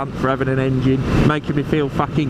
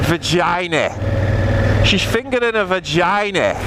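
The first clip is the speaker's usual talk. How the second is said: higher, hammed-up Scouse; Scouse accent; 5 semitones higher than usual. Shown as a demonstration. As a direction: say it in a put-on Liverpool accent. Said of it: "Try that in a Scouse accent".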